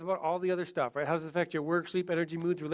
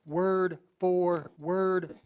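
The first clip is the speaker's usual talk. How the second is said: "Word for word" drawn out very slowly, each word weighted; slow emphasis; much slower than usual. Shown as a demonstration. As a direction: lean hard on "Word for word", slowly. Stressing the key point.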